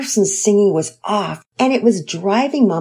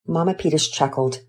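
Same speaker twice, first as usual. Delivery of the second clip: ordinary delivery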